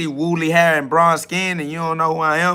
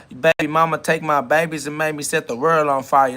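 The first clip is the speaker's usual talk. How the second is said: ordinary talk, nothing marked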